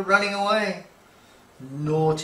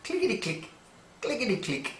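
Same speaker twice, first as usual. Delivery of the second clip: clicking claws; 7 semitones higher, 5 dB quieter, much faster than usual. Acted. Claws on the hallway.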